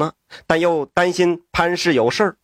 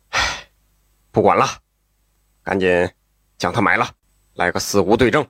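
much lower, cold ruthless voice; vicious man; 9 semitones lower, faster than usual. A narrator's reading. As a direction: growl the order to bury her. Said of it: "黄人狠狠地说道".

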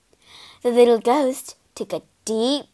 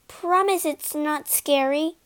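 nervous; 5 semitones higher, 4 dB quieter than usual. A narrator's reading. Nervous ghost seeks reassurance.

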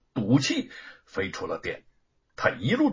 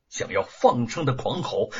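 ordinary delivery

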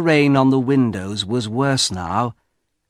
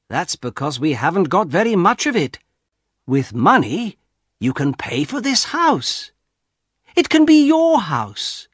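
eager persuading son; 8 semitones higher than usual. Acted. Son pressing his father to wish for money, eager. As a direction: talk Father into it with rising eagerness.

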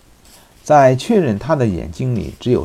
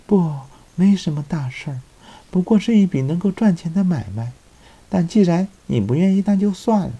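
put-on young woman voice; 9 semitones higher than usual. Woman's voice in the story.

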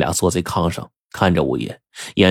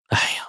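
soft drawn-out sigh of "aiya"; quiet resigned exhale; long sigh; 8 dB quieter than usual. A narrator's reading. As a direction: sigh heavily as the prince. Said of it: "长叹了一声".

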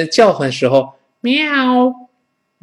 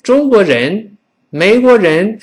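corrected foreigner's Chinese; 8 semitones higher, 4 dB louder than usual. Retold or imitated. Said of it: "你再读读看".

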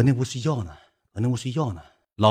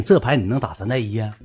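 ordinary talk

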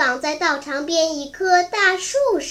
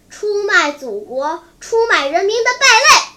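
building to a loud, high finish; angry denunciation; 4 semitones higher, 7 dB louder than usual. A narrator's reading. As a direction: hurl the accusation, rising in force. Prisoner denounces his captors as traitors. (愤怒地高声痛斥)

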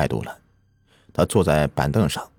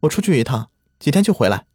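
calling out; 9 semitones higher than usual. A narrator's reading. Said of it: "她对灵儿喊道".

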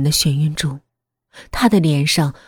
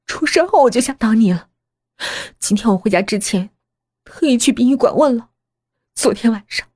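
higher-pitched, agitated daughter; tense accusation; 6 semitones higher, faster than usual. Acted. Daughter tells mother she checked her alibi, tense and accusing. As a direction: lay out the evidence with mounting agitation.

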